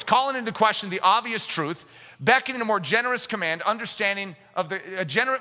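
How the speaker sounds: ordinary delivery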